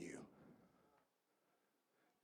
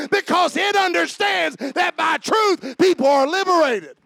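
preaching building to shouts; 9 semitones higher, 10 dB louder than usual. Acted. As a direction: build from firm preaching to a full roar.

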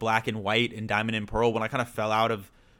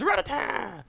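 anime voice; 13 semitones higher, 5 dB quieter, slower than usual. Retold or imitated. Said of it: "I'm pretty sure he goes".

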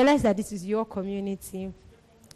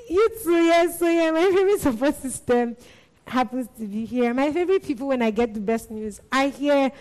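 higher, louder voice overcome with emotion; real choked-up laughter; 5 semitones higher, 4 dB louder than usual. Real, not acted. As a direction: laugh through the tears while praising friends. Overcome praising community, laughing and tearful.

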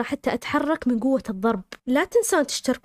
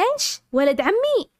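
high, startled outburst at her mother; a daughter shocked at the marriage news, pitched high; shocked raised voice; 8 semitones higher, slower than usual. Retold or imitated. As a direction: blurt it out in disbelief. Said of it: "لأول مرة أعلي صوتي على أمي".

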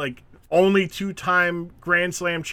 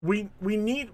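normal talking voice

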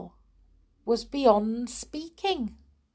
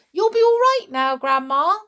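put-on high, quick, loud boy; proud reassurance; 8 semitones higher, 6 dB louder, faster than usual. A narrator's reading. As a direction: reassure Grandma brightly and proudly. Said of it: "George announced proudly".

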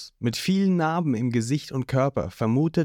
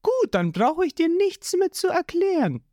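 put-on much higher, cold girl's voice; Nami's voice; 14 semitones higher than usual. A narrator's reading. Nami brushing off Zorro, high and cold. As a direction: dismiss him high and cool.

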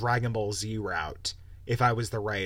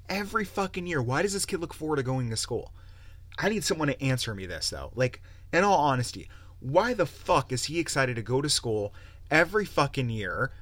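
ordinary talk, nothing marked

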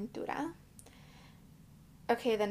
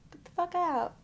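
emphatic; 8 semitones higher, 4 dB louder than usual. Real, not acted. Advising viewers.

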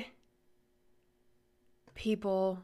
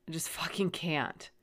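ordinary talk

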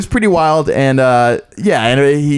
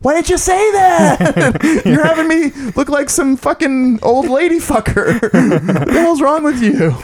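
much higher, slowed mock disbelief; exasperated son; 12 semitones higher than usual. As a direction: son scolding his mom afterwards. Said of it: "And I'm like".

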